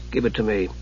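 low, hushed command; said softly. A narrator's reading. Raistlin asks nephew for the staff, softly. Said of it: "Raistlin said softly".

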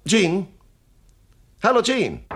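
high, loud call to the next room. Acted. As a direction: summon the secretary loudly. Boss calls secretary from next room.

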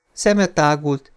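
usual speaking voice

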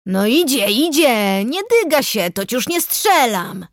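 drawn-out, sing-song rustic coaxing; coaxing forester. A narrator's reading. Forester urges the wary investigator to approach, coaxing. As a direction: beckon with stretched, swooping words. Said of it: "zachęcał leśniczy".

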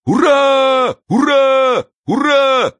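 high, ringing hurrah; drawn-out high cheer; crowd cheering. A narrator's reading. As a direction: cheer like someone in a rally crowd.

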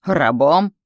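loud, incredulous scoff on one word; scornful snort. A narrator's reading. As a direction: throw the word back with scorn. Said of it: "фыркнул Матис". (презрительно, громко)

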